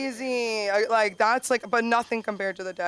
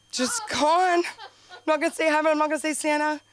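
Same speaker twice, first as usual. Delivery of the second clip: higher, exasperated explaining; 7 semitones higher, 4 dB louder than usual. Retold or imitated. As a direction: throw up your hands.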